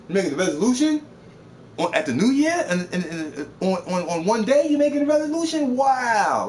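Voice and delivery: high, loud sarcastic disbelief; incredulous mockery. Real, not acted. Ridiculing New Year's resolutions to the stream, loud incredulous.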